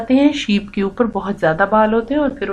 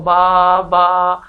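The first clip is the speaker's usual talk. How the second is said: long, monotone imitated bleat; sheep's bleat; 2 semitones lower, much slower than usual. Cow answering her friend with a sheep's voice, bleating. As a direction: draw the baa out like a sheep. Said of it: "بھیڑ کی طرح کی".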